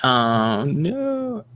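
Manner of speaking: glitchy robotic voice. Real, not acted. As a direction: voice breaking up like a robot. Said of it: "My voice is roboting".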